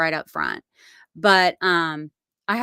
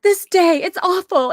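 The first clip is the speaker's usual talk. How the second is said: much higher and louder wail; panicked daughter; 11 semitones higher, 7 dB louder than usual.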